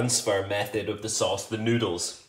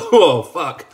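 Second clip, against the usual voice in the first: sudden loud pained yelp; shocked yelp; 6 semitones higher, 12 dB louder than usual. Real, not acted. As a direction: blurt it out as the heat hits. Reacting to burning hot sauce.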